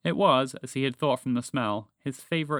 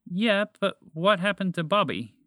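raised pitch, mild desperation; desperate mechanical voice; 7 semitones higher, 3 dB louder than usual. A narrator's reading. Spaceship asks after frozen friend, mildly desperate. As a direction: press the question with rising urgency. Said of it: "asked with more desperation in her mechanical voice".

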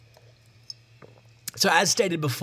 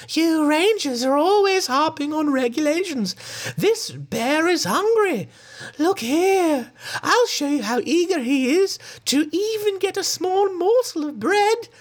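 silly lady. Acted. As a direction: coo it like a naive, doting lady. The lady character speaks.

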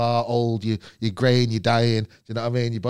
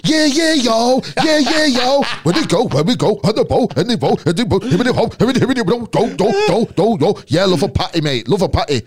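high, loud Manchester rapper put-on drawl; hammed-up nineties rapper, yelling yo; loud, high mock rapper drawl; mid-Atlantic rapper drawl; 9 semitones higher, 5 dB louder than usual. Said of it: "mid-Atlantic drawl that ... Manchester rappers".

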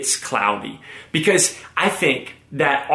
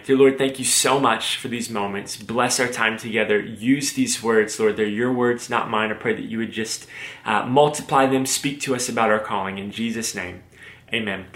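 soft, quiet prayer voice; 3 semitones lower, 4 dB quieter than usual. Real, not acted. Speaker praying over the sermon, soft and quiet. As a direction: pray gently and hushed, trailing softer.

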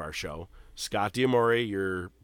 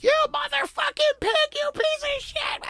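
much higher put-on voice, imitating her screaming at cops; mock yelling girlfriend; 3 dB louder than usual. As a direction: squeal it like a hammed-up angry girlfriend. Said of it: "Just start yelling at the cops".

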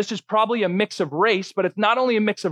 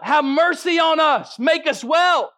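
mock shout; 7 semitones higher, slower than usual. Lepers shouting out to Jesus for mercy, mild mock shout. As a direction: call for mercy, a touch raised. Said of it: "The lepers shouted out".

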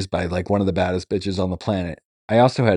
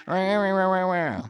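high, spoken rah rah laugh, much higher; mock Roseanne laugh; 9 semitones higher, 5 dB quieter than usual. Fans quoting his mom, a high mock laugh. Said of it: "never once has anybody quoted my mother without going".

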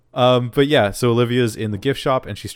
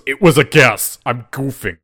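loud, higher mock protest; defensive retort; 4 semitones higher, 9 dB louder than usual. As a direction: protest loudly, imitating someone caught out. Acted defensive retort in recap.

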